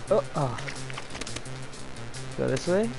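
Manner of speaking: usual speaking voice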